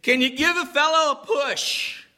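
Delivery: put-on high-pitched drunk guy asking a favor. Drunk guy at the front door in a storm, high-pitched plea. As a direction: call it out high, as a drunk stranger. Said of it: "there's this drunk guy standing there".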